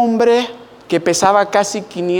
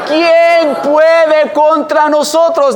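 "quién" stretched and stressed, very slow and loud; slow emphatic question; 6 semitones higher, 5 dB louder, much slower than usual. Shown as a demonstration. Preacher challenging the congregation, slow and loud. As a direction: drag out "quién puede contra nosotros" as a challenge. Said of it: "Nadie, nadie".

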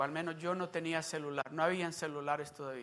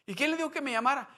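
higher, fast urgent question; first urgent question; 9 semitones higher, 6 dB louder, much faster than usual. Retold or imitated. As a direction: ask it fast, rattled. Sister asks who told him. (pregunta rápida y agitada)

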